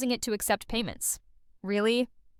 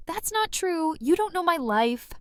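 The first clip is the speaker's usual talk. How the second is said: high-pitched mock retort; defensive clapback; 7 semitones higher than usual. Commentator mimics the streamer answering a chat comment, defensive clapback. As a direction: quote her snapping back, pitched up. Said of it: "she claps back with".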